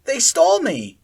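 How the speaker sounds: high, loud tearful cry; on the edge of sobbing; near sobbing. A narrator's reading. Akira protesting to Sally Ann, near sobbing. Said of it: "She was on the verge of sobbing".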